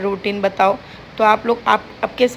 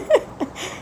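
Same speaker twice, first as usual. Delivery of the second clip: quick high giggle that falls away; real little laugh after the joke; short giggle; 11 semitones higher, 4 dB quieter than usual. Real, not acted.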